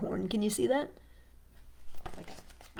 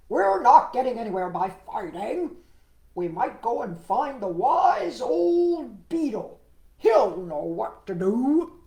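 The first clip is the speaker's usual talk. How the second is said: loud, slowing, commanding frog voice; forceful, drawn-out frog speech; chief frog shouting; 5 semitones higher, 10 dB louder, slower than usual. Acted. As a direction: take charge loudly as the head frog. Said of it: "the chief frog shouted".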